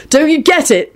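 high-pitched impatient question. A narrator's reading. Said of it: "he said impatiently to Ron".

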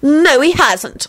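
high, drawn-out denial; acted high-pitched obstinacy; stubborn. A narrator's reading. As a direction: contradict slowly and stubbornly. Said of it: "Elizabeth replied stubbornly".

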